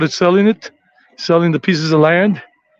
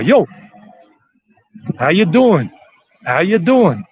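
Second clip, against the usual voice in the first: put-on New York street greeting; New York style; 2 semitones higher than usual. Shown as a demonstration. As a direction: greet casually in a New York accent, swooping pitch. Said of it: "New York style".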